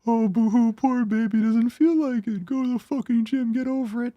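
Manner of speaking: high, whining mock baby talk; mocking a friend who whines about the gym; whiny bitch impression. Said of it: "in my best whiny bitch impression".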